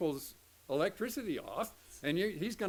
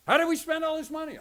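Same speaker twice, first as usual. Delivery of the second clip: loud, high mock yell at spouse; hammed-up couple fight over money; 10 semitones higher, 9 dB louder, faster than usual. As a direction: shout it like a frustrated spouse. Couple yelling about money.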